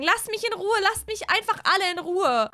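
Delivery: high, whining angry cry; upset rebuff. Acted. Hazel shuts Billy out of her room, upset. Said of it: "Und Hazel wird sagen".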